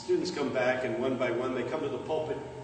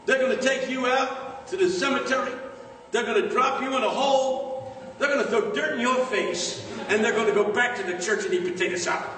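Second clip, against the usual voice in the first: higher, ringing preacher cadence; pastor's warning; 7 semitones higher, 3 dB louder than usual. Retold or imitated. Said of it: "He said".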